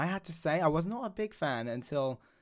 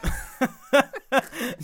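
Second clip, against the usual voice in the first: a loud high cackle of laughter; high burst of laughter; 11 semitones higher, 7 dB louder than usual. Real, not acted. Cohost laughing at her own awkward comment, high loud laugh. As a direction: break into loud, high giggles.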